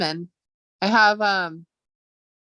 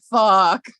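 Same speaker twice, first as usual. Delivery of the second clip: mock dismay; 6 dB louder than usual. Realising he forgot, cries out.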